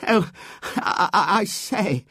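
high, halting, stammered gasp. A narrator's reading. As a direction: gasp out a startled protest in fragments. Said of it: "gasped Robert".

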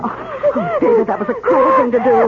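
high wailing, tearful; distraught crying. Acted. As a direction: cry out in anguish. Distraught over the girl.

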